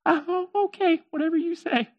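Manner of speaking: high, soft, uneasy little voice; nervous agreement. Acted. Merchant to talking camel, nervously agreeing.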